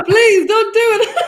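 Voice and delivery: high, slow drawn-out plea; playful pleading. Real, not acted. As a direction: beg them to stop, laughing. Pleading against love bombing.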